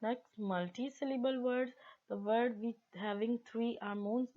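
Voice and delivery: ordinary delivery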